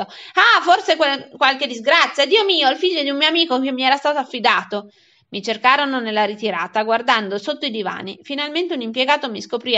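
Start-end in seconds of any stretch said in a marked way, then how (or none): 0.0-4.9 loud, raised, agitated voice; acted panicking guardian; frantic alarm. A narrator's reading. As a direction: exclaim as if dreading a disaster. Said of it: "esclamò a un tratto come fuori di sé".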